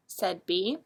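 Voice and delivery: ordinary delivery, nothing marked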